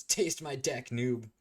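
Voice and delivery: high-pitched mock battle cry; cocky cartoon-rival taunt; acted battle taunt. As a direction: deliver a hammed-up finishing line.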